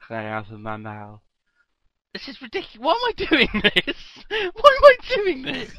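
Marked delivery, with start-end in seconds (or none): 2.1-5.7 laughing exasperation. Real, not acted.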